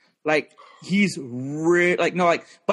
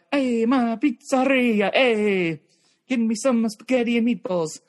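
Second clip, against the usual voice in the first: higher, hammed-up Italian accent; mock Italian accent; 6 semitones higher than usual. Comic joking about his heritage, hammed-up Italian accent. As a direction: stretch it like a cartoon Italian pizzeria owner. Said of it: "I know I sound kinda Italian".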